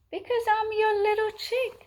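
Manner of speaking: very high, fast chick voice; little chick. A narrator's reading. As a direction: chirp it quickly as a little chick. Chick answers his mother.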